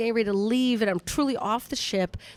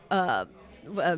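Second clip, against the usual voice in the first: ordinary talk, nothing marked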